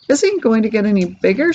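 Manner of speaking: ordinary delivery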